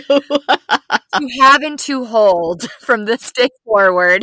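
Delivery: laughing through the vows; joking vows, breaking into laughter; mock wedding vows.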